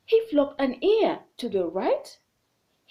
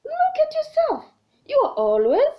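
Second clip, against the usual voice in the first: rabbit's retort, a bit louder and higher; mock shout; 13 semitones higher, 5 dB louder than usual. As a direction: snap back at the monkey. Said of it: "shouted Rabbit".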